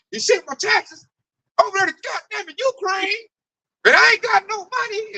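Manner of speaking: a hint of a griping coworker; mock complaining coworker. Coworker griping about taxes sent to Ukraine, mildly mocking. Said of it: "they are saying".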